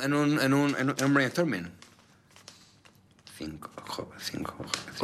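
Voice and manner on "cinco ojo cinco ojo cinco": near-whisper, barely voiced; thinking under breath. Real, not acted. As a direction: puzzle it out in a whisper, to yourself. Mumbling the riddle to himself. (susurrando para sí, pensativo)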